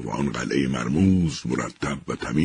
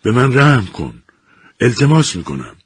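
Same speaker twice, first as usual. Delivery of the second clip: a terrified woman pleading with the machine; desperate pleading; 8 semitones higher, 7 dB louder than usual. A narrator's reading. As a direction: beg for your life, voice pitched up. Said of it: "دستانش را به التماس بالا برد".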